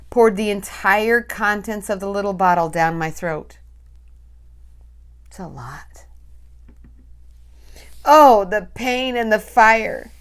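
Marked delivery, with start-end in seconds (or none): 5.3-6.1 whisper. Real, not acted. Whispered aside in narration.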